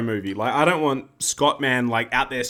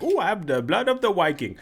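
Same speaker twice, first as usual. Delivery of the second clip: put-on Scandinavian accent; 4 semitones higher than usual. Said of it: "a Scandinavian guy that no one can understand".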